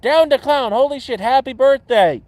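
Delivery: loud, high birthday cheer; real excited shout-out; excited greeting. Real, not acted. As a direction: burst out with delighted birthday wishes. Greets someone on their birthday.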